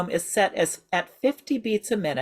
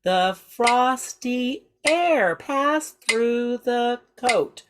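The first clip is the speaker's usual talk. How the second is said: rhythmic spoken line, exaggerated inflection; 3 semitones higher, much slower than usual. Shown as a demonstration. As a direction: speak it to the metronome, letting pitch rise and fall. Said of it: "Say it with me".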